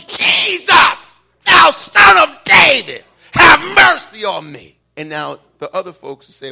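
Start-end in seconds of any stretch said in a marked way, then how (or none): 0.0-4.8 high, full-lunged cry for mercy; screaming plea. Acted. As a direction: scream it to the top of your lungs. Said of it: "screaming to the top of his lungs".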